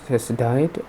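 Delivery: ordinary talk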